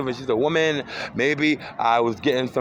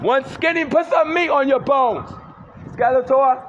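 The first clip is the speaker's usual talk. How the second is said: taunting shout; 12 semitones higher, 4 dB louder, slower than usual. Real, not acted. Yelling insults at passerby.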